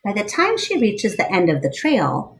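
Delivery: lively read-aloud storyteller voice; story narration. Acted. Reading a picture book aloud to children, lively storyteller voice.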